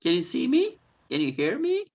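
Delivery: high sing-song pet talk. Real, not acted. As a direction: coo at the turtle. Greeting pet turtle.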